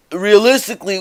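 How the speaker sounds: ordinary delivery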